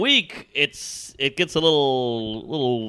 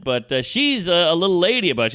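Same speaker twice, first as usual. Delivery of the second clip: ordinary delivery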